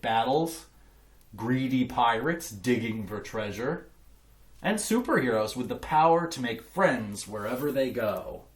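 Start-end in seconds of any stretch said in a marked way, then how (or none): none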